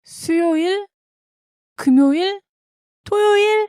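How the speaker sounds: 수요일, 금요일, 토요일 each lifted high like a question; three separate guesses, 수요일, 금요일, 토요일, each ending upward; rising question intonation. Shown as a demonstration. Guessing the day, 수요일, 금요일, 토요일 with rising intonation. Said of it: "just asking these questions".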